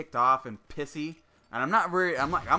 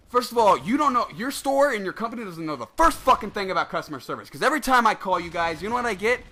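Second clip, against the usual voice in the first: real heated rant, high and loud; angry rant; 7 semitones higher, 8 dB louder than usual. Real, not acted. Gamer venting about GameStop customer service, angrily. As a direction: let the anger pour out, pitched up. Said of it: "I'm angry now".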